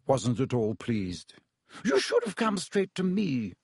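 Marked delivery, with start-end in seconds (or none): 1.8-3.5 high-pitched, indignant scolding; raging. A narrator's reading. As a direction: scold the patient crossly without shouting. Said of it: "she raged".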